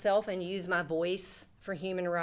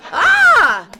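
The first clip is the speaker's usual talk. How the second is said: short, very loud high scream; mock panicked scream; 14 semitones higher, 19 dB louder than usual. Storyteller reliving the two little lines, loud comic scream.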